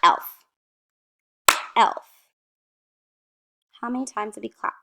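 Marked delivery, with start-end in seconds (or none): 0.0-2.0 "Elf" as one loud, short beat; one firm beat on each "elf"; clapped-out word. Shown as a demonstration. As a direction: punch "Elf" as a single syllable. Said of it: "So let's clap".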